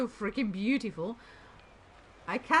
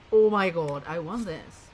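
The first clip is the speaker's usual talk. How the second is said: ordinary talk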